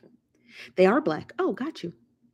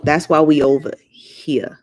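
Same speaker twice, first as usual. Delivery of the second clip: louder, slower pointed remark; emphatic; 3 semitones lower, 9 dB louder, slower than usual. Real, not acted. Vlogger telling chat where she stands. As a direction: lean on it hard. Louder take of repeated line.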